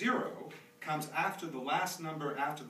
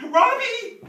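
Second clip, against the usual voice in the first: loud, high call across the house; 19 dB louder than usual. Acted. Father calling his son Robbie on the first school day, loud high call. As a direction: shout his name so he comes running.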